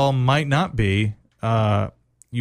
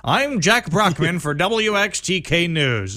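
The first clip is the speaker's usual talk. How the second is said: imitating a TV reporter signing off, slower at the end; smooth on-air news voice, a bit higher; 6 semitones higher than usual. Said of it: "he wrapped up his news story".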